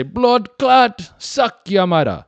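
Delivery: "Blood clot" high and loud in a bad Jamaican accent; hammed-up Jamaican patois imitation; mock Jamaican accent. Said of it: "Not a very good Jamaican accent".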